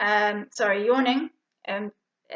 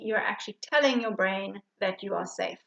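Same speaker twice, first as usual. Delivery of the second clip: ordinary delivery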